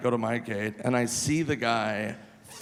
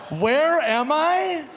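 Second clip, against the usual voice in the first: very high, loud and slow; mocking disbelief; 13 semitones higher, 5 dB louder, much slower than usual. Comic repeats the drunk's question, very high, slow and incredulous. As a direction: stretch it out in disbelief. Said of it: "repeating the drunk's line".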